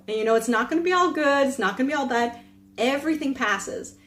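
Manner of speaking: ordinary talk, nothing marked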